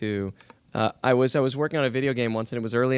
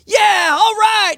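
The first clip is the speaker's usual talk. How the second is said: full-force, high, drawn-out yell; yelling call-out; 12 dB louder, much slower than usual. Shown as a demonstration. Game actor demonstrating snowboarding call-outs, loud excited yell. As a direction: holler it over the roar of snow and board. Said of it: "it's all, you know, yelling".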